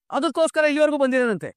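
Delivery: high, indignant raging questions; outraged father. Acted. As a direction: rail at the greedy groom. Father raging over money motive. (ಕೋಪದಿಂದ ಏರಿದ ಧ್ವನಿ)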